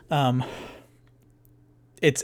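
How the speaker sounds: usual speaking voice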